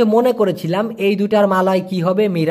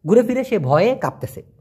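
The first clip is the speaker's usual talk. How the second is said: usual speaking voice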